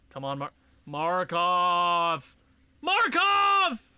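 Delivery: beg, then shout the name; frustrated yell. Real, not acted. Gamer pleading with his goalie, then shouting.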